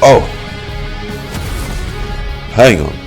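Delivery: ordinary talk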